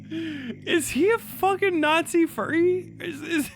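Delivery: high, slow and disbelieving; shocked high-pitched question; incredulous shock. Real, not acted. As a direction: ask it in stunned disbelief. Shocked at discovery.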